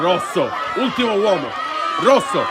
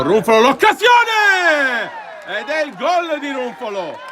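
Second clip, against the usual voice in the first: goal shout; 6 semitones higher than usual. Real, not acted. Goal chance, live commentary.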